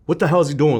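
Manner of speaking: imitating a mad father, high and loud; retold anger. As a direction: act out dad's angry outburst. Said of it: "And then he gets mad at her".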